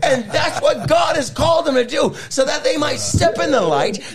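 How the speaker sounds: fiery shouted preaching. Real, not acted. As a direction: preach it at full force, climbing high.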